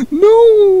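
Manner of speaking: high, drawn-out "no"; dismayed wail. Real, not acted. Streamer protesting the flapping on screen, dismayed wail. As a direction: wail it high in playful despair.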